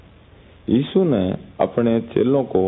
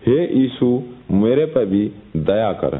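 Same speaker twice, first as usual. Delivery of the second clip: ordinary delivery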